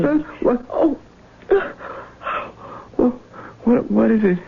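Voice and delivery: high, breathless jolt from sleep; startled awake. Acted. Husband startled awake by wife, frightened. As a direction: wake up frightened. Waking from nightmare.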